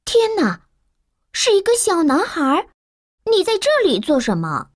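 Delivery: put-on a maid startled to find a boy; servant woman. A narrator's reading. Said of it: "佣人警告说". (受惊的女佣声音)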